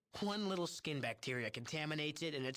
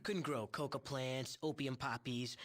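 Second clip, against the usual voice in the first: ordinary delivery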